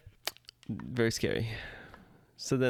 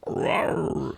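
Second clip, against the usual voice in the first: a hint of a cheesy monster roar; half-hearted haunt roar; mock growl; 5 semitones higher than usual. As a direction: growl it like a tired scare actor. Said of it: "the little growl that everybody does".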